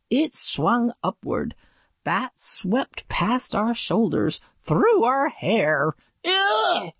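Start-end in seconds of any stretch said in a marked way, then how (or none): 6.2-6.9 high disgusted ugh; high yelp of disgust; yell of disgust. A narrator's reading. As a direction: yelp as the bats brush past. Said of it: "Jeremy yelled".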